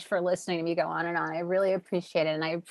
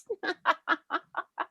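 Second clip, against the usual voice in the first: delighted laugh; 13 semitones higher than usual. Real, not acted. Puppy on camera.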